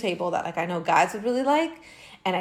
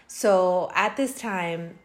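usual speaking voice

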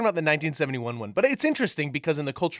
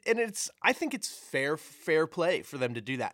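ordinary talk, nothing marked

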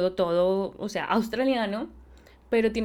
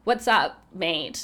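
slow, low Australian greeting; lightly done Australian accent; 3 semitones lower, 3 dB louder, slower than usual. Shown as a demonstration. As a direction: greet a mate in an Aussie accent. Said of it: "acá en Australia suena un poco más".

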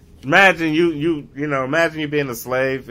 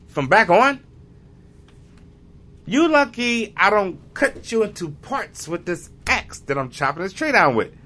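defiant freed slave; 9 semitones higher than usual. Retold or imitated. Freed slave defies master.

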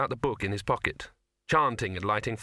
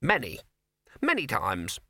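sour rabbit; 8 semitones higher than usual. Acted. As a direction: count it off sourly as a rabbit. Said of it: "said the rabbit sourly".

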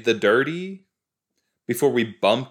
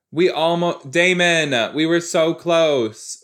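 loud, higher-pitched protest; exasperated scolding; 7 semitones higher, 6 dB louder, slower than usual. Real, not acted. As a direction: wail at him for spoiling it all. Scolding a character for killing.

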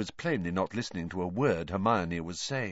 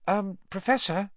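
high, tentative call; hesitant, polite, pitched up; cautious; 12 semitones higher than usual. A narrator's reading. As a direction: try gently to get his attention. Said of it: "Hermione approached Professor Lupin cautiously".

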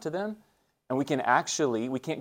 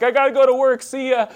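much higher and louder, a breezy brush-off; mock hurried dad; 12 semitones higher, 10 dB louder than usual. Father dropping his crying daughter at school, hurried brush-off. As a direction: toss it off like a dad rushing away. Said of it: "And I'm like".

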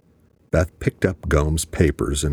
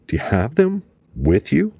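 excitedly; 10 semitones higher than usual. A narrator's reading. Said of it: "he asked excitedly".

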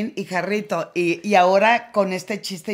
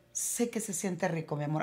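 put-on tender to a child; 3 semitones lower, 11 dB quieter than usual. Mother gently tells her child.

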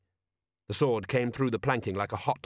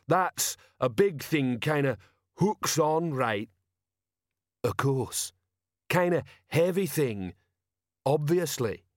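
slow, pitched-up character voice thinking aloud; ponderous Detritus; 4 semitones higher, slower than usual. Acted. Detritus working out what an anchor was, slow musing. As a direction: piece it together slowly, in halting chunks.

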